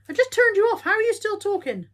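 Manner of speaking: loud, high-pitched disbelief at the culprit; exasperated scolding. Real, not acted. Owner telling off a talking toy that won't switch off, exasperated.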